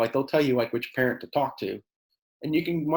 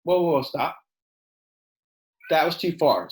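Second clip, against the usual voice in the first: firm stop; 2 semitones higher than usual. Retold or imitated. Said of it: "my response is like".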